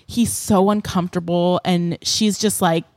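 usual speaking voice

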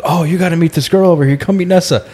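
quick, high, excited pushing; eager friend. Retold or imitated. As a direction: hurry him over to meet her. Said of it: "and he goes".

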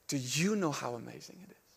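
low, very soft, searching question; whispered question. Retold or imitated. Spirit whispering in the preacher's heart, low and hushed. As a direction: hush it down and ask intimately. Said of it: "the Spirit whispered in my heart".

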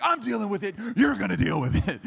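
exasperated father, punching it out; fed-up dad. Retold or imitated. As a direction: lay down the law to your son. Fed-up dad retelling his scolding.

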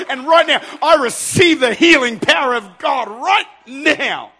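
loud, high declaration, drawn out to the end; shouted faith declaration; fervent prayer. Shown as a demonstration. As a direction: claim it at full voice, stretching the last words. Models a fervent prayer aloud.

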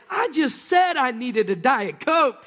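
the barista marvelling at the pizzas and Cokes; excited barista. Retold or imitated. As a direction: act out her disbelieving delight. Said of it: "And she says".

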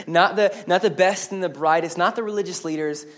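ordinary delivery

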